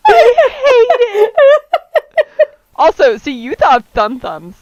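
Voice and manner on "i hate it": high, loud laugh-cry, slowed; laughing hard. Real, not acted. Co-host hears the horny translation, laughing hard and loud. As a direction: crack up while protesting.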